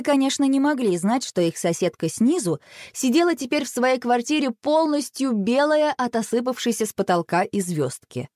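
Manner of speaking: plain reading voice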